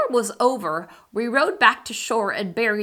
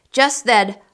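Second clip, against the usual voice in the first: ordinary delivery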